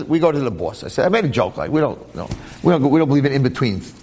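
ordinary talk

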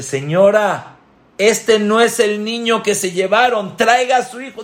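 high, loud disbelief; astonished doctor. Acted. Doctor telling the mother this isn't her child, high and loud. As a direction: refuse to believe it's the same child.

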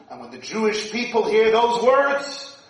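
ordinary talk, nothing marked

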